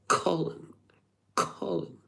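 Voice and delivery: high airy posh English Colin. Retold or imitated. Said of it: "like, posh English".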